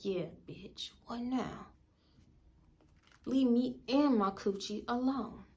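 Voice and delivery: very soft, deadpan aside; unspoken retort. Retold or imitated. Guest just looking at her friend, muted retort. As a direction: say it under your breath, as if only thought.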